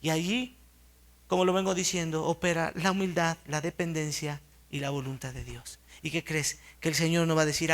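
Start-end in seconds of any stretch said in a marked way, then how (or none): none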